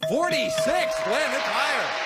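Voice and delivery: spring the answer on the contestant; excited reveal. Real, not acted. Host reveals the survey answer.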